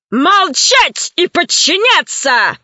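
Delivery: slow, hard order that cuts him off; stern voice. A narrator's reading. Bandit cutting off the captive scientist's questions, stern slow command. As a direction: bark it out like a jailer. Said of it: "оборвал его суровым голосом".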